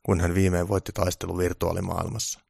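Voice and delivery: ordinary delivery, nothing marked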